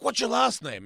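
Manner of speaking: high, loud demanding question; retold confrontational demand; imitated challenge. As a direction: mimic a pushy challenger.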